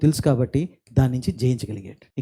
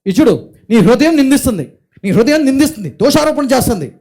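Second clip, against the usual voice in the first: higher and louder, pressing the congregation with questions; forceful preaching; 9 semitones higher, 7 dB louder than usual. Acted. Pastor urging listeners to trust God over their heart, fervent preaching. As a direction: hammer the point home from the pulpit.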